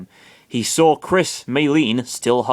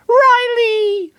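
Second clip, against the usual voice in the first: much higher and louder, far-off squeak; high Lucario cry; 6 dB louder than usual. Acted. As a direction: call out his name in a squeaky creature voice. Lucario names his trainer.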